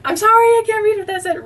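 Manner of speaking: high, fast, giggling apology; high, fast laughing sorry. Real, not acted. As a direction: squeak it out through a laugh. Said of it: "laugh cry".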